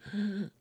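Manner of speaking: short wheezy gasp for air; mock wheezing breath. Shown as a demonstration. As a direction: demonstrate the allergy wheeze with one gasp. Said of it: "wheezing as I was breathing".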